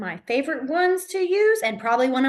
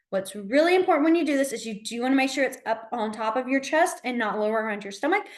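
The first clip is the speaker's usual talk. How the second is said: usual speaking voice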